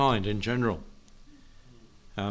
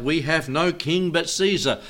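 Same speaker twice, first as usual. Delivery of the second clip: raised, higher mob cry; crowd crying out; 6 semitones higher, 4 dB louder than usual. Retold or imitated. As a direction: call it out as the angry crowd. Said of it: "And they cried out".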